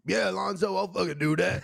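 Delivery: put-on impression voice; Angel Pitts. Agreeing to do Alonzo's show, put-on impression. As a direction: do a mimicking caricature of another comic. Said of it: "That's a good impression".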